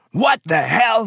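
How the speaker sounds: high, loud furious yell; angry yell. Acted. Foreman yelling at the worker over wet samples. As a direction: explode at the wet paint. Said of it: "he yelled".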